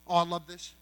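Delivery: ordinary delivery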